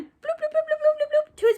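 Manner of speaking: high playful bloop bloops; running sound effect. Acted. Teacher voicing the pig running off, high bloops. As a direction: bounce the bloops up high like little feet.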